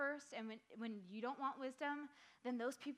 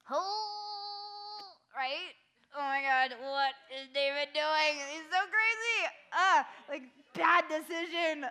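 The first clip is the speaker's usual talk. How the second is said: high, loud, dramatic disbelief; shocked outrage; 5 semitones higher, 11 dB louder, slower than usual. Acted. Youth leader reacting to David's affair, loud shocked outrage. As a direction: gasp and overplay how shocking it is.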